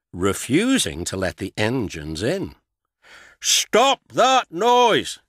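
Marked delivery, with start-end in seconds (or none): 3.4-5.2 much higher, louder, slow bellow. A narrator's reading. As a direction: roar at the noisy engines. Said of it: "he bellowed".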